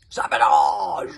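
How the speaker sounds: low, loud, slow roar; furious roar. A narrator's reading. Chief berserk roaring at the stuck dragon. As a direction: roar it in outrage. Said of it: "roared the berserk".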